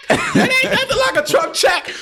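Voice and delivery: delighted shouted boast; gleeful shout. Acted. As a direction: shout it out like a hype man. Gleeful boast about money.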